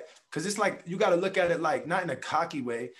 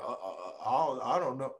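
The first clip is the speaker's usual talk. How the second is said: fumbling stammer; 4 semitones lower, 4 dB quieter than usual. Retold or imitated. Imitating a caught-out person.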